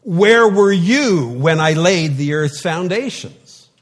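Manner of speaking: loud, high and slow, booming challenge; commanding voice from the whirlwind; thundering God. Shown as a demonstration. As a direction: boom it out like an angry God.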